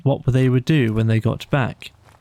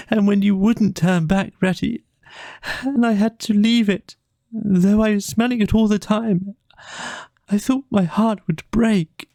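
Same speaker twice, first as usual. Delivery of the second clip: much higher, crying out his loss; sobbing Mole; 10 semitones higher than usual. Acted. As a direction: pour it out through tears. Mole's sobbing confession to Rat.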